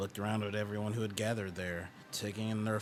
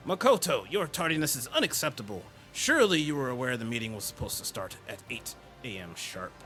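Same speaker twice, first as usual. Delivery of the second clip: stern scolding; 5 semitones higher than usual. Acted. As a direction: scold him like a rule-obsessed prefect. Stern hall monitor scolding.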